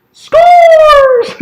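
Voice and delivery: screaming high and loud as the tying goal goes in; ecstatic goal yell. Real, not acted. Fan roaring at the screen for the tying goal, ecstatic yell. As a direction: let it rip, full-throated goal call.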